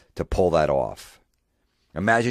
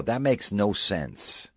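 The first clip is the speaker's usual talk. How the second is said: put-on blunt kid; 5 semitones higher, much slower than usual. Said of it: "the little kid's gonna go".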